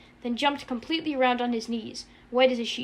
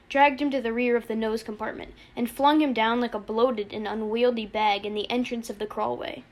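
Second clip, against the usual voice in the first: ordinary delivery, nothing marked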